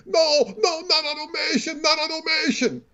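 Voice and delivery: high, put-on Chinese accent; mock Chinese accent. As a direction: plead in a caricatured Chinese accent. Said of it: "the Chinese guy".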